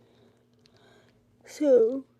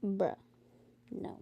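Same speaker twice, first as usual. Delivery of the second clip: ordinary talk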